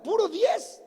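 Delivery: "puro diez" pitched high for emphasis; emphatic repeat. Real, not acted. Preacher telling congregation of brother's perfect report card, emphatic "puro diez". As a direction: lift "puro diez" well up, marvelling.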